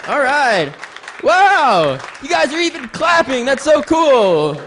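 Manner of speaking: loud, high, delighted exclamations; excited. Real, not acted. Comedian thanking a clapping crowd, excited.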